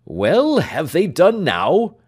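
high, loud, slow demand; doctor hurrying the servant; impatient. Acted. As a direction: press him hard.

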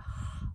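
brief voiceless sigh through the mouth; quick airy demonstration breath out; exhale sound. Shown as a demonstration. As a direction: let out a short open-mouthed "haa".

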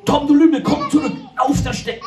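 high furious shout after the goat; angry yell. Acted. Farmer Otto yells at goat Tom to come back. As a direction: call the runaway back, loud and high.

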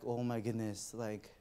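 soft, slow muttered exasperation; quiet impatient sigh. Retold or imitated. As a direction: grumble under the breath. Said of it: "So I'm like".

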